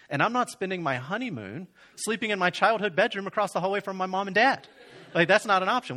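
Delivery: high-pitched mock protest; exasperated refusal. Groom refusing to honeymoon at his parents', hammed-up exasperation. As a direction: protest emphatically, rising in pitch and volume.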